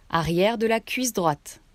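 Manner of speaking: normal talking voice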